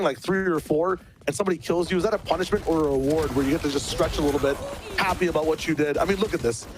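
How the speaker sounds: ordinary talk, nothing marked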